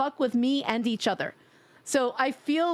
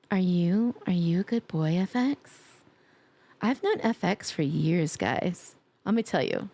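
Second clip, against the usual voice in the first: low, slow teasing voice; sultry tease; 7 semitones lower than usual.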